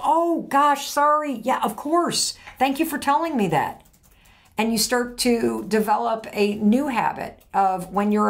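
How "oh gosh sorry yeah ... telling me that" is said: imitating a partner, pitched higher and eager; apologetic partner. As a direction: play the partner who instantly says sorry, voice up high. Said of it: "And they go".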